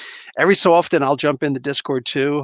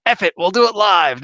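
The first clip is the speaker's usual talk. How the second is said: raised, forceful mock outburst; Bill O'Reilly quote; 6 semitones higher, 4 dB louder than usual. Streamer quoting an infamous on-air outburst, mock exasperation. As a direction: lightly imitate an exasperated TV host. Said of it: "not so great Bill O'Reilly comment".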